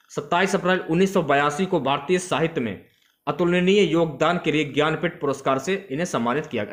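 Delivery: normal talking voice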